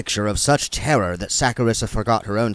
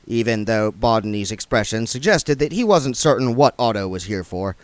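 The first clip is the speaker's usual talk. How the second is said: ordinary delivery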